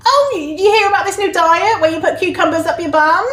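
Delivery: high, breezy gossip voice; change the subject with bright chatter; chatty distraction. Retold or imitated. A friend distracting from the boundary, breezy and high. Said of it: "for them to be like".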